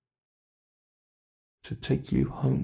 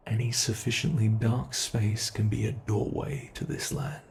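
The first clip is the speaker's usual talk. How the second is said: usual speaking voice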